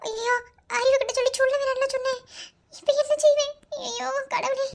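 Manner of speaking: high, distressed fretting cries; panicked wailing. Acted. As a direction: wring your hands and wail in panic. Woman panics at the news. (பதற்றமான புலம்பல்)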